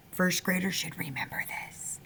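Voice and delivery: hushed aside whisper. Real, not acted. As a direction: drop to a whisper.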